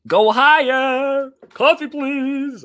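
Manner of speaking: high, loud, drawn-out shouts; playful calling out. Pretend. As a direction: holler it out, stretching each phrase.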